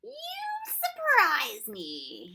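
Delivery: high, loud, drawn-out exclamation; Proc's character voice. A narrator's reading. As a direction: stretch it out slowly and loudly, swooping in pitch.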